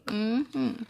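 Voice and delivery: usual speaking voice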